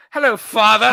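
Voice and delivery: hammed-up greeting in a mock film voice; Harry Potter character. Friend imitating a Harry Potter villain, hammed-up. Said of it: "who was the guy in Harry Potter".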